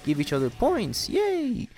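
fast, high, chirpy announcement ending in a squeal; cheery announcer. Retold or imitated. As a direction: play the gleeful host. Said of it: "Kagane actually comes and says".

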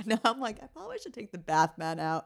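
talking through laughter; laughing. Real, not acted. Mom laughing at her own bath mat story. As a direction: giggle while getting the words out.

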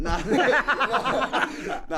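cracking up at his own joke; laughing. Real, not acted. Host to his partner after a joke breakup, laughing.

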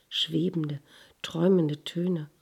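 usual speaking voice

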